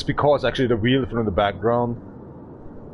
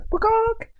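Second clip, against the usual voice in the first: high squeal. Acted. Joke shout then just kidding.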